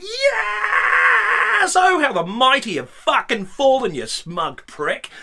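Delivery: high, loud triumphant shout, slowing at the end; the room gloating at the smug guest; gloating cheer. Retold or imitated. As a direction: crow over his downfall. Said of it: "the rest of the room are like".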